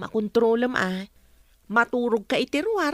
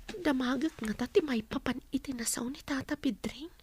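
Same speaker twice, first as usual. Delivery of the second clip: softer unvoiced confiding question; a secretive hushed question; whisper; 7 dB quieter than usual. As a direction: whisper it so no one else hears. Wife whispers question to husband.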